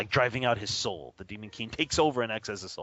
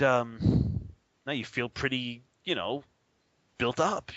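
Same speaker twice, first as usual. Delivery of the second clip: usual speaking voice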